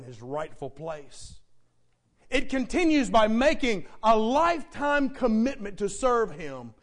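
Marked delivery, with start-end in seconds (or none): 2.3-6.7 loud, driving pulpit delivery; emphatic, raised preacher's voice; forceful preaching. Real, not acted. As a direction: hammer out the charge to the congregation.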